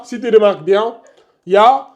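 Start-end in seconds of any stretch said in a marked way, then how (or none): none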